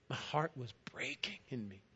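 whisper. Acted. Grief watching the crucifixion.

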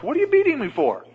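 high, loud, laughing donkey voice; talking donkey. Retold or imitated. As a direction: squeal it out like an indignant donkey. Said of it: "said in a human voice".

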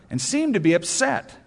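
usual speaking voice

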